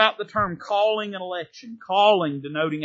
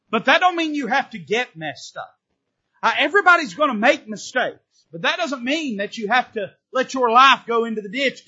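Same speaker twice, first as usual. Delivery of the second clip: higher and louder, driving each phrase home; preaching at full force; 6 semitones higher, 6 dB louder than usual. Acted. Preacher pressing the congregation not to let life fall apart, at full force. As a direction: preach it hard, building line on line.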